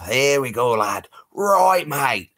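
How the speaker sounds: a light, hammed-up Australian voice; mock Aussie accent. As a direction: greet a fan like a cheery Aussie bloke. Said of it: "My accent's going".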